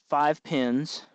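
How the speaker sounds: normal talking voice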